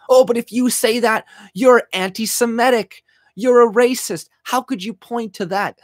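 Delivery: high, sneering parody of a scold; scornful caricature of an outraged critic; mocking whiny voice. Mocking critics who cry racism.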